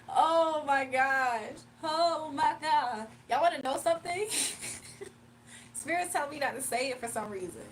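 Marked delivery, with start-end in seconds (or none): 0.1-5.7 loud, slow, drawn-out words through laughter; cracking up and can barely get the words out; real giggly disbelief; laughing fit. Real, not acted. Laughing fit over a funny comment.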